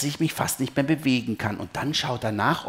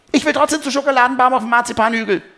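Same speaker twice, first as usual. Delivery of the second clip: hoch, laut und schnell herausgerufen; 12 semitones higher, 8 dB louder, faster than usual. A narrator's reading. Pin beharrt lautstark auf ihrem Wunsch. (trotziges, schrilles Kindergeschrei)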